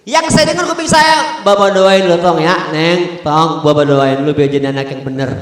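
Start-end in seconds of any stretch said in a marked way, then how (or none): 1.4-5.3 tender blessing. Retold or imitated. Betawi father praying over his child, low and tender.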